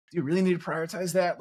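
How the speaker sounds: high, pointed incredulous question. Real, not acted. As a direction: challenge them in disbelief. Rhetorical challenge.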